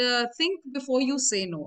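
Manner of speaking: ordinary delivery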